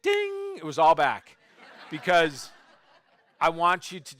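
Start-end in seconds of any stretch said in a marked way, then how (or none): 0.0-0.6 high, ringing, sustained "ding"; bright-idea bell sound, pitched way up; imitated bell ding. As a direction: sing out a bell chime on one held high note. Imitates a bell of sudden realization.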